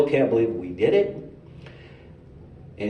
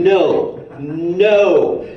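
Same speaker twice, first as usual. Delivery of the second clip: higher and louder, firm commands; stern talking donkey; 6 semitones higher, 6 dB louder, slower than usual. Acted. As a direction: scold like a donkey laying down the law. Donkey rebukes Saul.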